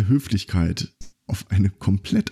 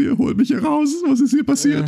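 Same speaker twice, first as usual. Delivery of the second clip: bewildered inner voice. Retold or imitated. Persian visitor thinking in the pub, high and baffled. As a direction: sound utterly baffled by the scene. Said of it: "denkst du".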